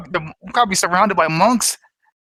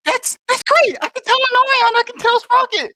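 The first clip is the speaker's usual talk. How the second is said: excited. Acted. Excited reply on magic phone.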